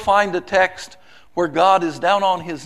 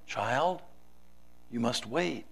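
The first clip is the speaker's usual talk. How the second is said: softer and lower, a gentle answer; 5 semitones lower, 10 dB quieter than usual. Acted. God gently answering the pleading child, softly. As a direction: hush it down like a patient father. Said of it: "the master gently said".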